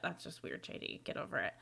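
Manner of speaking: whispered, half-breathed scoff; muttered aside. Real, not acted. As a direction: mutter it under your breath. Mock scolding aside to author.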